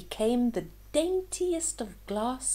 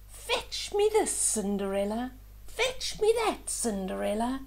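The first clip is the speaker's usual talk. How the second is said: bossy ugly sisters. Acted. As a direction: order her about like spoiled sisters. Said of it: "the ugly sisters said".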